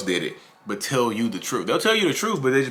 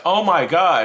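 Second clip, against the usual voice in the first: louder, higher gasp of disbelief; mock shock; 8 semitones higher, 7 dB louder, slower than usual. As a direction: act out being taken aback. Acts out hurt reaction to insult.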